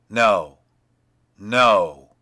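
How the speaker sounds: loud, firm no, no; loud refusal. Acted. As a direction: shut her plea down hard. Bluebeard refusing her plea.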